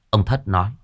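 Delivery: ordinary delivery, nothing marked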